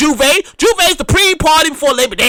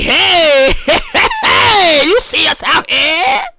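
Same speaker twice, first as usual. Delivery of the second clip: partier on Snapchat; much slower than usual. Retold or imitated. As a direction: show off to followers on Snap. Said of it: "they on Snap like".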